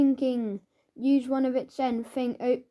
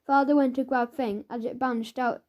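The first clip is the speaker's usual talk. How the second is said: ordinary delivery, nothing marked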